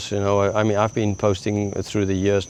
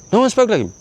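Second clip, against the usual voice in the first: amazed officers; 12 semitones higher, 4 dB louder, faster than usual. Retold or imitated. Officers' reply in acted exchange.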